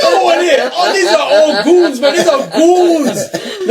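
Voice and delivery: high, laughing disbelief with a long drawn-out "goons"; incredulous outburst. Retold or imitated. Friend recounting his shock at seeing the crew as goons, incredulous. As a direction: retell it amazed, pitched right up and stretching the words. Acting his astonished reaction at club.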